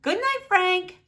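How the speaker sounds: high goodnight to himself; lonely Frank. A narrator's reading. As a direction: say goodnight to yourself. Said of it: "says Frank".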